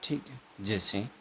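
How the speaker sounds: normal talking voice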